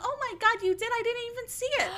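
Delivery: imitating the mom. A lifeguard mimics the mother hearing her son fell in, high-pitched. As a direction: play a surprised mother fussing over her son.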